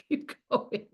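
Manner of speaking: breathy laugh through words. Real, not acted. Laughing over her own line.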